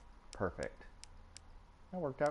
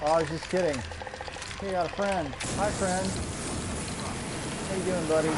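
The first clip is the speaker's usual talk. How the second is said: mock friendly; 9 semitones higher, 6 dB louder than usual. Greets an enemy mockingly.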